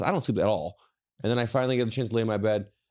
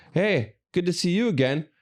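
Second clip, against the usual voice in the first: higher, slow, sugary fake politeness; mock friendly; 8 semitones higher than usual. Greeting the TSA lady again, mock friendly and slow. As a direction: greet her as if delighted, dripping sarcasm. Sarcastic greeting to same agent.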